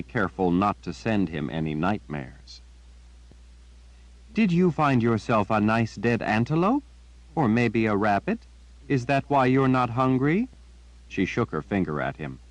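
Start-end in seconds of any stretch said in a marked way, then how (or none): 4.3-10.5 lightly voiced girl teasing her pet; Jillian, teasing. A narrator's reading. Girl teasing her raven about a meal, lightly voiced. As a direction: playfully quiz the bird.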